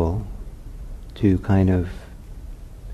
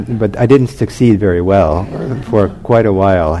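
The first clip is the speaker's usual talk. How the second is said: laughing; 7 dB louder than usual. Real, not acted. Laughing at own sleepless night.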